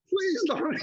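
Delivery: high, comic begging with a laugh near; squeaked-up retold plea; mock pleading. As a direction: plead in pretend alarm. Acted plea not to oversell him.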